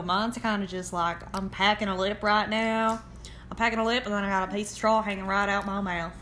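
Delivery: put-on bumpkin southern accent. Co-host picturing her backcountry character, bumpkin southern accent. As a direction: play a country bumpkin with straw in the mouth. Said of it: "my southern's a little more bumpkin".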